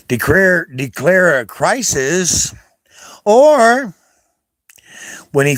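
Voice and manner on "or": loud burst. Real, not acted. Ranting about the president.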